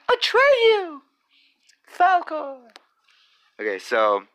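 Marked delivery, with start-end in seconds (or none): 0.1-2.7 high, ringing call. Pretend. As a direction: shout the names across a distance. Shouting movie character names.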